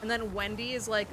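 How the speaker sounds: ordinary talk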